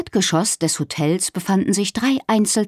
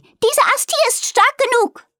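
very high call from up the tree. A narrator's reading. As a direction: call down from the branch. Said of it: "rief sie".

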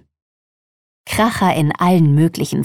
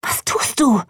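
much higher, alarmed call of a name; horrified hiss; 7 semitones higher than usual. A narrator's reading. Jenny hisses at Holly in horror. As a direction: sharply stop your friend. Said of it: "raunte Jenny entsetzt".

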